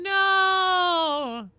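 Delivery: high, loud shocked cry. Real, not acted. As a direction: cry out in dismay. Reacting to game news.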